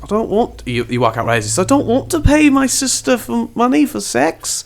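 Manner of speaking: high, squeamish protest; flustered brother. Acted. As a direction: object in a high voice. JP voiced again.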